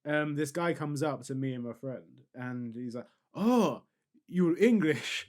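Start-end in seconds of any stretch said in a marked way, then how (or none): 3.3-5.3 imitated foreign accent, raised pitch; drawn-out "Oh", then a mock foreign accent; put-on foreign accent. Street tout spotting two tourists, put-on foreign accent. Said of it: "I'm gonna stop doing the accent".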